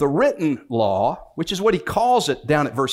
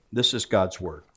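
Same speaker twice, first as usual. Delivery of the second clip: normal talking voice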